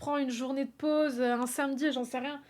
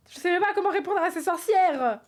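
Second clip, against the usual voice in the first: louder and higher, fed up; annoyed outburst; 6 semitones higher, 6 dB louder than usual. Real, not acted. Streamer fed up with the witch riddle: loud annoyed outburst. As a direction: vent at the puzzle. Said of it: "je suis en train de m'énerver".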